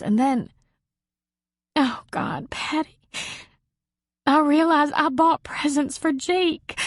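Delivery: the realization cracks her open, rising high; breaking down. Acted. Marie telling Patty about the gifts, climbing into tears.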